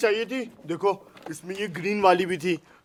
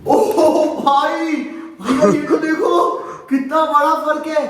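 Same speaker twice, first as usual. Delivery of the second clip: higher, louder amazed exclaiming; 7 semitones higher, 4 dB louder, slower than usual. Real, not acted. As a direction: gasp at how big the difference is. Comparing two play buttons.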